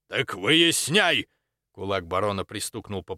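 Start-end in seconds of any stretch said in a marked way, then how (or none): 0.1-1.3 loud, drawn-out, raised command; angry order. A narrator's reading. Baron orders the exorcist to find out, angrily. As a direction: order it loudly, stretching the words.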